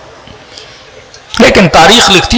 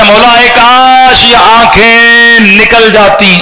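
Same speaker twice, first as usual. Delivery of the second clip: weeping; 7 semitones higher, slower than usual. Acted. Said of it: "رو کر کہتا".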